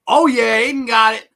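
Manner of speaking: loud, high shout of approval; high, pumped-up quiz host; excited cheer. Real, not acted. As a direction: cheer the winner loudly. Cheering a correct answer.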